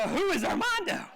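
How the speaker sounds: amused question. Retold or imitated. Asking grandma about Armando.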